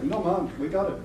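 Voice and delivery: lightly put-on Jamaican lilt; imitated Jamaican accent. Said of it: "they were from Jamaica".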